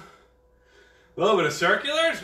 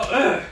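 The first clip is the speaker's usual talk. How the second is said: short high loud grunt as if just struck; mock grunt of pain; 6 semitones higher, 5 dB louder than usual. Shown as a demonstration. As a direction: act out taking a hit. Demo of being hit standing still.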